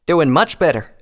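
loud, bright forced cheer; enthusiastic. Acted. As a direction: put on a show of being fine. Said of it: "replied with enthusiasm".

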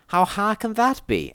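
high, breezy question with a grin; grinning. A narrator's reading. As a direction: grin through an overconfident question. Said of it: "I said with a grin".

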